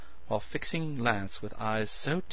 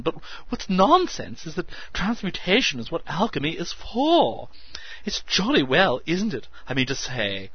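excited; 10 semitones higher, 9 dB louder than usual. A narrator's reading. Meadows excitedly lecturing.

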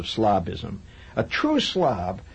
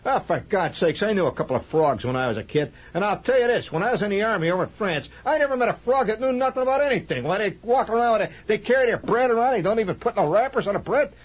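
Archie Bunker voice; 8 semitones higher than usual. Pretend. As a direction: play a blustering Archie Bunker type. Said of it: "He said".

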